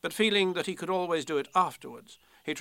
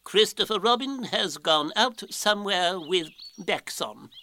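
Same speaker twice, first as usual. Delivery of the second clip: learned Owl, confidently bluffing; 5 semitones higher than usual. Acted. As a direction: announce a grand deduction as Owl.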